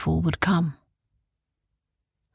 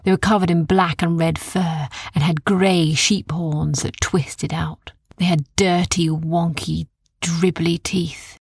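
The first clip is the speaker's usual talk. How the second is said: plain reading voice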